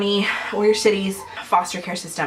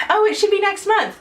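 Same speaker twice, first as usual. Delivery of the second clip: mocking; 12 semitones higher than usual. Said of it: "every time I post a video where it's like".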